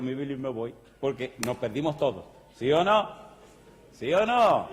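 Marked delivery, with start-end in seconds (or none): 2.6-4.7 loud, high challenge to the crowd; booming call for a response; rousing question. Real, not acted. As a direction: demand an answer from the pews. Preacher challenges congregation.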